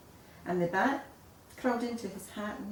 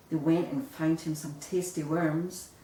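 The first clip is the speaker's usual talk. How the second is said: usual speaking voice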